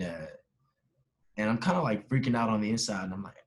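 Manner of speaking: normal talking voice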